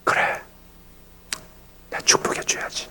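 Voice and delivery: soft unvoiced whisper to himself; the pastor quietly deciding to bless the deacon, in a whisper; whispered resolve. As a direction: breathe it out as a private decision.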